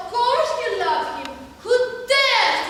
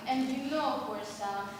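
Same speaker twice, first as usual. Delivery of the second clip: ordinary delivery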